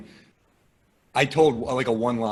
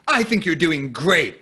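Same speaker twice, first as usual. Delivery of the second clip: slow, higher, emphatic reassurance; pitying encouragement; 4 semitones higher, 5 dB louder, slower than usual. Retold or imitated. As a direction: imitate a crowd member's overdone consolation. Voices woman consoling the bombing comic.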